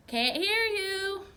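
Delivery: very slow, high, loud reply; shouting back. A narrator's reading. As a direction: yell back across the room. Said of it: "Dad responded".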